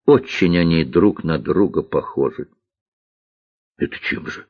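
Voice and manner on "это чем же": hushed, puzzled question; surprised. A narrator's reading. Cook questions girl about generals, mildly surprised. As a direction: ask softly, a touch surprised. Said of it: "удивилась повариха".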